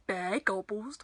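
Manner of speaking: usual speaking voice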